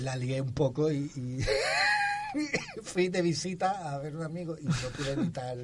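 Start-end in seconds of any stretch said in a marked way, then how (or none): 1.5-2.8 risa aguda casi llorosa; ríete agudo, casi sollozando; risa chillona. Real, not acted. Risa al contar el accidente. (risa aguda casi como llanto)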